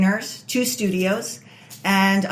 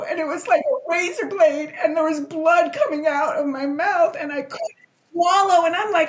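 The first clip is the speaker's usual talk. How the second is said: tearful retelling; 8 semitones higher than usual. Real, not acted. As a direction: voice rising high and trembling with held-back tears. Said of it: "I could cry".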